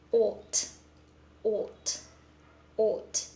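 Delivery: ought said with a rounded British aw, /ɔt/; British ought sound. Shown as a demonstration. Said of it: "it is being read as".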